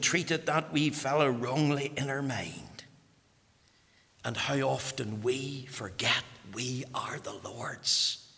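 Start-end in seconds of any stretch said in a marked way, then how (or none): 4.2-8.3 slow, whispered reflection. Real, not acted. Preacher reflecting to the mourners, slow and whispered. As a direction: breathe it out gently, slowly. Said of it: "quiet reflective application".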